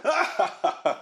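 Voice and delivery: high mock "ha ha ha"; put-on laugh, starting high and dropping; retold scoffing laugh. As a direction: imitate laughing off a suggestion. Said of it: "And I looked at him and went".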